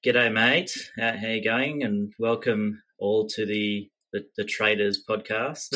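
put-on slow, drawn-out Australian accent; Aussie drawl, laid-back and lazy; laconic outback drawl. Mock Australian greeting to open.

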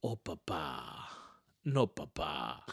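low, soft, slow mock-sad child voice; toddler disappointed. Son disappointed when father finishes last, low soft mock sigh. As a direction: sigh it like a let-down little kid. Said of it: "llego último y dice".

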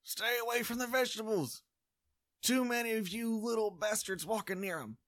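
high-pitched shouted warning; guard yelling out at strangers. Acted. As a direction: holler at trespassers like a cranky guard. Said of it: "and he yells".

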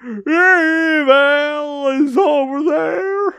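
high-pitched cartoon skit voice; skit character. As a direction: declaim in a high, put-on hero voice. Voices figures in own skit.

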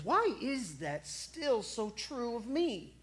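usual speaking voice

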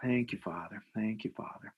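soft, hushed repeated prayer; prayerful. Real, not acted. Speaker thanking God mid breathing exercise, softly prayerful. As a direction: say it gently, letting it fade.

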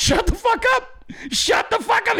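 shrill high-pitched yell of shut up; high shout. Acted. Yelling at listeners who don't know anything. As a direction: shriek it at them.

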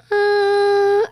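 long, high drawn-out filler; hesitant thinking. Retold or imitated. Seven-year-old weighing whether to tell mom, hesitant. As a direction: stall on a high hum while deciding. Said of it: "actually thinking about".

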